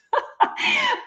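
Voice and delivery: a sudden, very high laugh at herself; high burst of laughter. Real, not acted. Host laughing at herself after losing focus.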